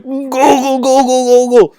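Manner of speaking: loud, high gargled Wookiee warble; comic creature noise made of rolled nonsense syllables; Chewbacca. Acted. Chewie answers Han, in a loud warbling gargle. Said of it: "And Chewie's like".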